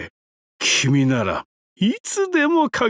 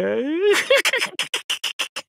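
amused chuckle; 11 semitones higher than usual. Acted. After taunting the visitor.

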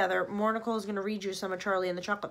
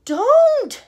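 high, loud quick cry; urgent shout; 17 dB louder than usual. Acted. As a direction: stop her in time. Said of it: "said Mister Wonka quickly".

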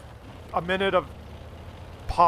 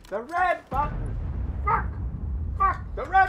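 frantic mom. Retold or imitated. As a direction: shout it like a panicked mother. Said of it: "I hear my mother going".

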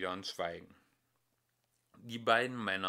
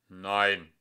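firm voice; 2 semitones lower, 8 dB louder than usual. Acted. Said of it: "mit fester Stimme".

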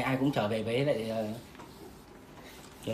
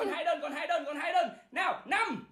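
excited; 13 semitones higher, slower than usual. Real, not acted. Countdown, high but softer.

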